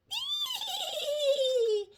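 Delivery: high, wavering little squeal; long fluttery high-pitched giggle-squeal; excited squeal. Acted. Butterfly thrilled with her Easter egg, excited squeal. Butterfly answers the question wordlessly.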